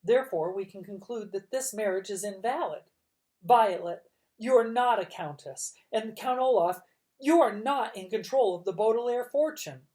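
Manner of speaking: a judge declaring the marriage invalid, firm and loud; stern judge ruling. A narrator's reading. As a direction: lay down the verdict firmly. Judge voiced in story.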